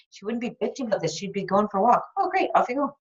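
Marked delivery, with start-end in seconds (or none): 2.2-3.0 high, breezy, cheerful send-off; cheery fit self. Pretend. Coach voicing healthy fit Jody sending herself off, high breezy cheer. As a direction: chirp it like your upbeat ideal self.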